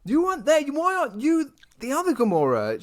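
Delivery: high, loud indignant yell at the screen; mock shout. Shouting at Gamora on screen while watching, mock outrage. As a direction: yell at her like a betrayed fan. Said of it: "I just kind of shouted out".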